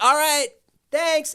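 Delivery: high, quick sign-off; cheery goodbye, unsure; awkward cheery thanks. Retold or imitated. Imitating David leaving the fans, high and awkward. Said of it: "he'd be like".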